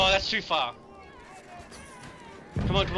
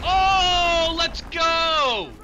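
excited cheer; 11 semitones higher, 5 dB louder, much slower than usual. Real, not acted. Big in-game win.

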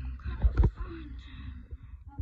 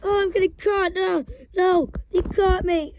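panicked; 5 semitones higher, 6 dB louder than usual. Real, not acted. Caught by the animatronic.

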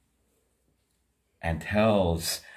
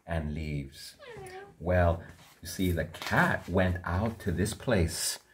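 plain reading voice

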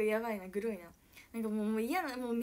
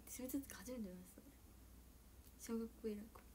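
soft whisper; 12 dB quieter, faster than usual. Real, not acted. Trailing off into a whisper.

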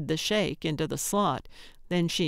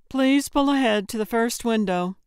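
high, raised order-taker voice; drive-through speaker voice; 5 semitones higher, 4 dB louder than usual. A narrator's reading. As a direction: recite the instruction through the box. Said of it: "the voice in the box said".

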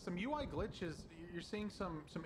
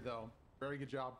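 usual speaking voice

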